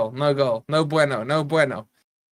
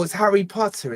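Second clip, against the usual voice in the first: normal talking voice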